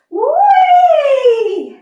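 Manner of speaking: high, loud gleeful whee; excited whee. Acted. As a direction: squeal it with delight. Playful cheer during kids' song.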